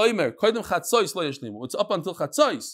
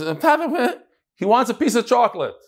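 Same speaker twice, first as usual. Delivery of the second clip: put-on mother pleading for child; 6 semitones higher than usual. Mother voicing child's wish to father.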